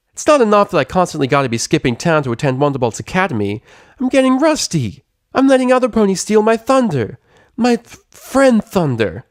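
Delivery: high, loud whining rant; frustrated rant. A narrator's reading. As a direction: rant about losing her edge. Rainbow Dash ranting.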